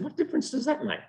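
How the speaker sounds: high, baffled weasel; puzzled weasel. Acted. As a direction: ask it in disbelief. Weasel voiced in fable.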